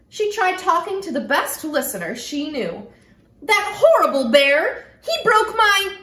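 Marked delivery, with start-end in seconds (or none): 3.4-6.0 higher, louder and indignant; upset complaint. Acted. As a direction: pour it out to her stuffed toy. Girl complains to her stuffy.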